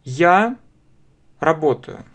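ordinary delivery

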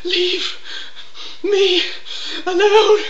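very high, loud drawn-out wail; shrieking Coughlin. Acted. Impression begs to be left alone, very high loud wail. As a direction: wail each word like a tantrum. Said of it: "here's my impression".

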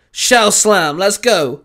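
rally the Pokemon forward; calling out. A narrator's reading. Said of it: "Baz called out".

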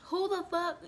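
ordinary talk

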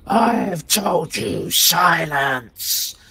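loud, high, drawn-out furious shriek; ghost's scream. Acted. Ghostly librarian screams at cultists and players for silence, loud scream. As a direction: shriek it at the top of your lungs. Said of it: "screams at the top of her lungs".

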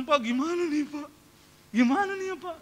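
high, pleading mock-anxious voice; imitated frightened woman. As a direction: mimic a worried woman seeking reassurance, pitched high. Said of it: "Mukanya takut". (tiruan ibu ketakutan, nada tinggi)

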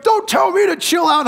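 high, shouted angry comeback; angry retort. Retold or imitated. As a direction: snap it back like a furious player. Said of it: "And he's like".

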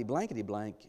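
usual speaking voice

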